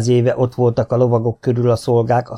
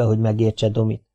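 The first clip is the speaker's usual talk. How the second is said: usual speaking voice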